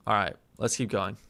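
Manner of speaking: ordinary delivery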